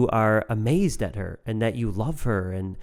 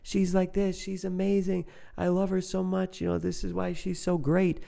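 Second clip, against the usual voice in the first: much higher, gushing with delight; amazed and adoring; 8 semitones higher, 4 dB quieter than usual. Retold or imitated. Husband gushing about his wife, thrilled and in awe. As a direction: rave about her like you can't contain it. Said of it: "No, you should be telling".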